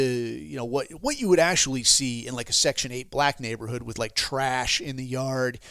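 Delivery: normal talking voice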